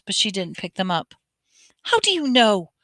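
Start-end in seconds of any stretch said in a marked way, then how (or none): 1.8-2.7 startled, sputtering question. A narrator's reading. McGonagall caught off guard by Harry, sputtering. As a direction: blurt it out in shock. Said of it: "she sputtered".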